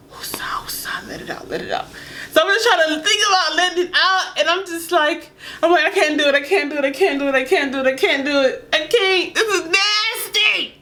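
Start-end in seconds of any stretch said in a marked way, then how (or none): 0.1-1.9 whispered woosah breathing, much softer; calming whisper. Retold or imitated. Calming herself before peeing on the guy, whispered. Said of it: "trying to breathe in my mind". 5.8-10.7 laughing, rising panic; flustered refusal. Retold or imitated. Balking at the guy's request, flustered and laughing.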